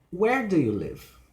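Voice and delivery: high "Where" dropping to "live"; falling question. Shown as a demonstration. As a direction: punch "Where", then slide down. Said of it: "Information question".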